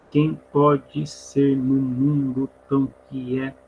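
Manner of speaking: slow, split QUEM PODE SER into drawn-out syllables. Shown as a demonstration. Teacher scanning a verse for students, syllable by syllable. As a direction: stretch each syllable, stop on stressed É. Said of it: "como é que é a divisão silábica".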